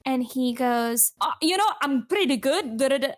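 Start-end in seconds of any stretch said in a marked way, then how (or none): 1.2-3.1 half-hearted Scottish impression, a bit higher; attempted Scottish accent. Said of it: "accent, I can't do it".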